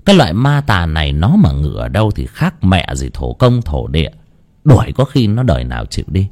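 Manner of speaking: usual speaking voice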